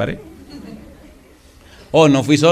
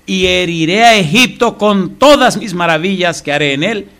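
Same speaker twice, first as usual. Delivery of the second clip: scripture reading; 4 semitones higher, 5 dB louder than usual. Acted. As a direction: proclaim the verse to the congregation.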